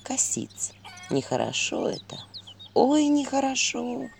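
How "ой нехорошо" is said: ordinary delivery, nothing marked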